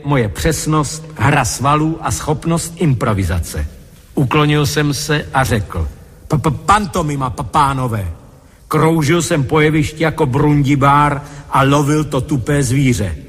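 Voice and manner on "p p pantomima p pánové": stammered announcement, tripping on each P; stuttering. Acted. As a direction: announce it with a stutter on first sounds. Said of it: "moje vrozená koktavost nebude na závadu". (koktavé ohlášení)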